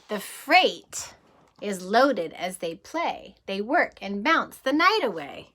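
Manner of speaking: lively rhythmic storybook read; reading to children. Acted. Teacher reading a train book to kids, lively storybook voice. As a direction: read the rhyme like a bedtime adventure.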